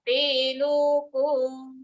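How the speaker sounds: Te loko slowly, each vowel held long; chanted Te loko with drawn-out e and o's; three long sounds. Shown as a demonstration. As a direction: stretch te, lo and ko in Te loko. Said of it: "All these three sounds nice and long".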